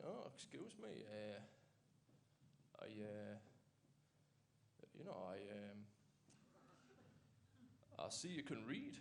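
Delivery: very soft, halting, faltering approach; awkward chat-up attempt. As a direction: fumble a smooth pick-up line with long hesitations. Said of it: "I'm gonna try that myself".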